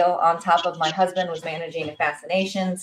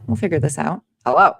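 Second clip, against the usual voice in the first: ordinary talk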